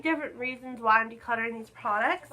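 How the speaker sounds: normal talking voice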